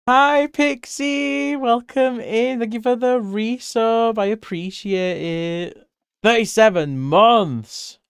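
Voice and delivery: high, drawn-out streamer welcome; excited thanks. Real, not acted. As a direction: greet the subscriber brightly. Thanking a resub.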